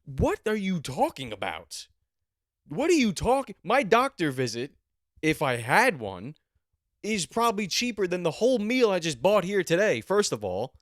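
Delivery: loud, high, indignant rant; mock outrage. Ranting back at the woman about doctor prices, loud and indignant. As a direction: build up outrage and shoot the pitch up.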